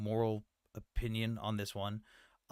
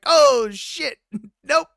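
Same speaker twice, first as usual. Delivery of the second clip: loud, much higher startled yelp; mock panicked backing off; 13 semitones higher, 14 dB louder, much slower than usual. Pakistan voiced reacting to fake tweets, high startled yelp. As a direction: yelp it like a spooked official. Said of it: "when they saw that Pakistan was like".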